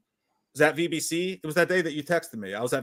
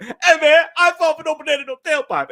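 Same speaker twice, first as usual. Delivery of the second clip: loud and much higher, a big show-off movie voice; hammed-up Eddie Murphy line; 14 semitones higher, 7 dB louder than usual. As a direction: belt the quote out like Eddie Murphy. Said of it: "And then I even did the thing".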